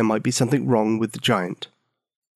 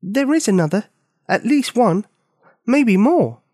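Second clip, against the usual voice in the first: matter-of-factly; 9 semitones higher than usual. A narrator's reading. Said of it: "quite matter-of-factly".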